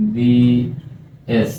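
এমবিবিএস drawn out very slowly, opening on a clear "em" sound; sounding out MBBS. Shown as a demonstration. Teacher shows students the vowel start, very slow. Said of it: "আমি যদি উচ্চারণ করি এম".

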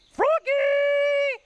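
long high call for the frog. Acted. Grover calling to a hidden frog, loud and high. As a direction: sing out Froggy across the woods.

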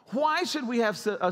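usual speaking voice

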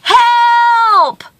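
long, high cry for help; one drawn-out high holler; storyteller's scream for help. A narrator's reading. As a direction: call out as if stranded on a mountainside. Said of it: "and he screamed".

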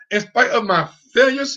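normal talking voice

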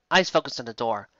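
ordinary delivery

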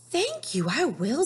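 put-on high, happy little-girl voice; Amabel. Amabel thanking the crocodile, in a high, bright voice. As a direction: squeak it out sweetly. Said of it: "said Amabel".

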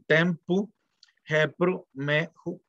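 "Tempu, Hepru, Mehu" palabra por palabra, con pausas; lectura egiptológica. Shown as a demonstration. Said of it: "entonces ahí dice". ("Tempu, Hepru, Mehu", lectura convencional pausada)

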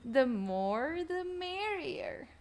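second go at the impression, lilting up high; imitating someone else's voice. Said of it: "that's how they sound".

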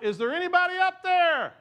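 high, loud desperate call; shouting out. Acted. Hanging man shouting up from the cliff, high and loud. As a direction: yell up the cliff for help. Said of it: "He begins to shout out".

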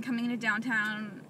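ordinary talk, nothing marked

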